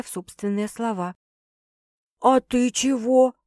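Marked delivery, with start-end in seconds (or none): 2.2-3.3 slow, grim question; gloomy. A narrator's reading. Sister asking how she responded, gloomy. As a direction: ask it slowly, darkly. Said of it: "мрачно поинтересовалась сестра".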